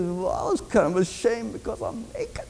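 small, soft squeaky confession; mock-ashamed little voice; sheepish squeak. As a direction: squeak it out, barely aloud. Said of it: "you can hear him kind of squeak it".

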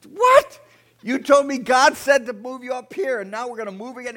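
high, loud indignant outburst; acted shriek of disbelief, then loud protest; outraged wife. Wife told they're moving again, outraged protest.

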